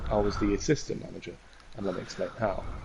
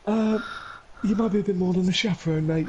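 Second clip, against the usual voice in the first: higher, quick, nervous coach voice; flustered coach; 8 semitones higher than usual. Retold or imitated. Coach hinting to Keane he must help, higher quick voice. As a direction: rush the news out, pitched high. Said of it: "He was like".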